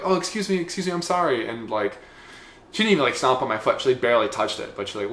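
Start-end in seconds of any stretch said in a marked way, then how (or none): 0.0-1.5 higher-pitched imitated apology; apologetic lady. Translating the tram lady's apology, high apologetic voice. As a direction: play a lightly flustered woman saying sorry.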